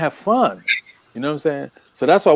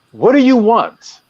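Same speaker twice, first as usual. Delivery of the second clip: "What do you want?" slow, higher and louder, each word pressed; stressed question; 8 semitones higher, 6 dB louder, slower than usual. Shown as a demonstration. Doctor pressing women on their wants, slow and emphatic. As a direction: lean hard on "What do you want?", slowly. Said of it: "I can't stress that enough".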